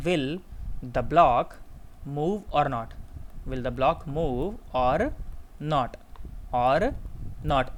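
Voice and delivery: slow and low, long pauses between words; word-by-word dictation. Real, not acted. Teacher dictating the exam question to students, haltingly slow. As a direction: dictate for someone writing it down.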